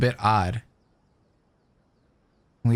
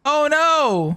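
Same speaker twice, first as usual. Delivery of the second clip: high, loud dismayed "oh no"; shocked outcry; 3 dB louder than usual. Acted. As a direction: cry out in stunned dismay. Shocked at the mother revelation.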